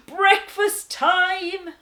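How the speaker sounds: roar. Acted. Hungry lion sister roaring at breakfast, loud and slow. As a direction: bellow it with delight. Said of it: "roared my".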